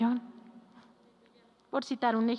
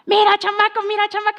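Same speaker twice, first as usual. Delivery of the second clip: put-on high, loud yell at the kid; father yelling; 9 semitones higher, 10 dB louder than usual. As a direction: bark at the boy in a rage. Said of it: "Y hace algo mal el hijo".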